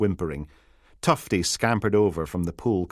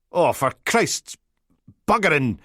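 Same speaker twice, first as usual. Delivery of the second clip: exasperated curse; 7 semitones higher, 4 dB louder than usual. Acted. Biohazard curses in frustration.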